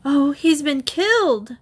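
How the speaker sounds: terror-stricken. A narrator's reading. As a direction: exclaim it in horror. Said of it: "Terror-stricken".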